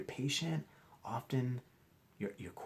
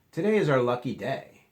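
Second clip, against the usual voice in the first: higher, louder happy teacher; cheery announcement; 5 semitones higher, 11 dB louder than usual. Acted. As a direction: announce it to the class with glee. Teacher character announces excitedly.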